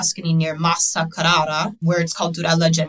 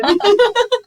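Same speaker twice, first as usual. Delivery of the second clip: high laugh; 7 dB louder than usual. Real, not acted. Laughing at own joke.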